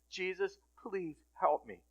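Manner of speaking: slow, soft prayer for help; quiet plea. Retold or imitated. Struggler praying to Jesus, quiet plea. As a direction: plead softly, word by word.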